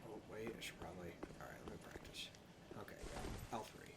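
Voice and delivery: unvoiced whisper. Real, not acted. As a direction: whisper to yourself while concentrating. Whispering while lining up jump.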